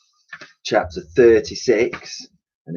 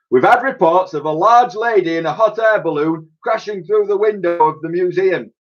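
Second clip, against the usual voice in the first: high, loud officious police voice; Commissioner Barker; 10 semitones higher, 4 dB louder than usual. A narrator's reading. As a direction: announce it like a pompous policeman.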